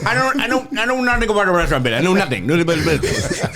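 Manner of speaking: hammed-up, high mobster protesting; loud, high, stumbling protest as a mob boss; Paul Sorvino. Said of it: "This is Paul Sorvino in Goodfellas".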